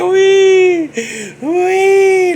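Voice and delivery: loud, high pig squeal; mock pig squeal, long and piercing; Ned Beatty's squeal. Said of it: "poor Ned Beatty was like".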